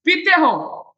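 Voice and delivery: पीते punched hard, falling after; stress on पीते. Shown as a demonstration. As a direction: lean on पीते to insist. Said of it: "क्या बोलूंगा मैं". (पीते पर ज़ोर देकर)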